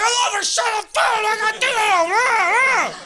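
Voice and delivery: high, strained shouting; frantic yell. Retold or imitated. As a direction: yell back defensively at the neighbors. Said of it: "I'm like".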